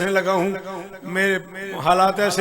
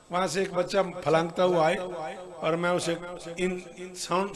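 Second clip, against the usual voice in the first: normal talking voice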